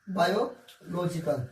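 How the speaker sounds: बायोलॉजिकल drawn out slowly, every syllable clear; careful pronunciation. Shown as a demonstration. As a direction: spell out बायोलॉजिकल slowly for the class. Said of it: "pronunciation पे थोड़ा ज्यादा focus किया कीजिए".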